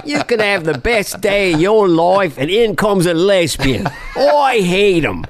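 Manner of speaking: high, drawn-out Australian comic rant; hammed-up Australian comedian; Australian accent. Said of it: "it's not my best accent".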